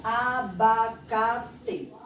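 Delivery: abacate drawn out very slowly; slow classroom demonstration; slow demo word. Shown as a demonstration. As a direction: stretch each syllable of abacate, very slow. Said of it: "Olha só a palavra".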